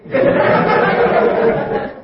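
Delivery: airy high chuckle at the punchline; breathy laugh. Real, not acted. Preacher laughs at his own joke before the congregation, high and airy.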